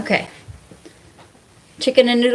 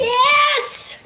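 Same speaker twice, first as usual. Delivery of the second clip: squealed mock yes. Mock enthusiasm about gossiping.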